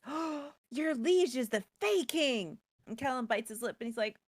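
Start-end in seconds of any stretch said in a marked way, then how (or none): 0.1-2.6 slow, higher dawning voice; lightly voiced Ruby, slow and higher; Ruby's realisation. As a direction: draw it out as the penny drops. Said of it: "Ruby stares at Kellen and says".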